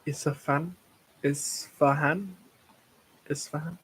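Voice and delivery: "Isfahan" tried carefully with questioning rises; tentative pronunciation. Real, not acted. Streamer trying not to butcher a city name, "Isfahan" said tentatively. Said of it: "I don't wanna butcher pronunciation".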